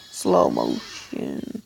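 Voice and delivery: very slow, deep, dragged slow-motion voice; mock slow-mo voice. Gamer mimicking a slowed replay, deep stretched slow motion. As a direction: drag each syllable like a slowed-down tape. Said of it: "I wanna see it in slow-mo".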